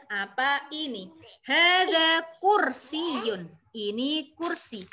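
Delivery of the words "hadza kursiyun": hadza maktabun, each vowel drawn out; slow, loud teacher's model of hadza maktabun; slow vowelled reading. Shown as a demonstration. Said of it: "sambil diharokati". (hadza maktabun dibaca pelan dengan harakat)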